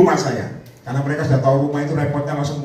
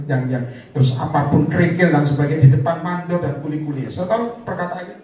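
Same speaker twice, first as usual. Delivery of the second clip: ordinary talk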